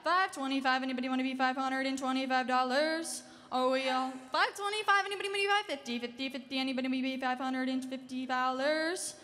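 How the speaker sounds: rapid rhythmic auction chant; auctioneer. Acted. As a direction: rattle off bids in a fast chant. Live auction bid calling.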